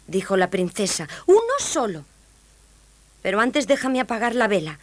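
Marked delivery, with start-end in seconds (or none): 1.3-4.8 high, grudging princess; cartoon princess. A narrator's reading. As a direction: set terms in a high voice. Princess sets terms. (princesa resignada con voz aguda)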